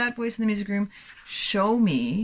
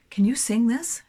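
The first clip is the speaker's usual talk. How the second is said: higher, lightly acted child asking; child's eager question; 6 semitones higher, 4 dB louder than usual. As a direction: ask a relative hopefully. Child asking someone at home.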